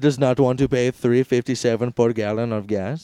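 put-on Indian accent, comic retort. Raj hits back at Trump in mock debate, Indian accent. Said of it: "you can do an Indian accent".

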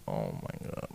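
low, muttered dismay under the breath; groaning under breath. Retold or imitated. Handed to the boyfriend, a low groaned dismay. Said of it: "I'm like".

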